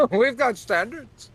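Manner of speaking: high, loud, slow laughing echo. Real, not acted. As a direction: repeat it through laughter.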